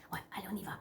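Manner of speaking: unvoiced whisper. Real, not acted. Host whispering to viewers to start the reading. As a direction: whisper it close to the mic. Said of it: "parler doucement comme ça".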